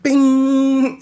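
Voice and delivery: sharp high bing, loud; high loud bing for the knee landing; bing sound effect. Retold or imitated. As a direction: ring out the hit like a bell.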